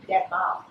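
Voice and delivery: "devil" said as deh-BAHL; "devil" with B for V and an open "ah" ending; Kristang pronunciation. Shown as a demonstration. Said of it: "It's pronounced devil".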